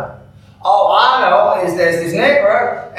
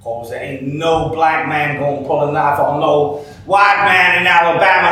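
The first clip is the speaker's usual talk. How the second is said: ordinary talk, nothing marked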